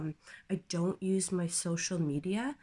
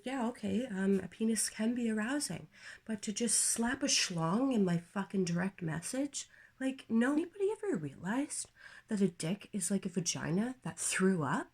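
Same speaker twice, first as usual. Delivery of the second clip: ordinary delivery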